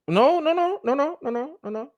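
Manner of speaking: high-pitched string of objections; urgent correction. Real, not acted. Co-host correcting who won the contract, urgent. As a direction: jump in high to stop a mistake.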